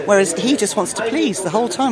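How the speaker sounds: usual speaking voice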